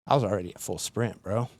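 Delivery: normal talking voice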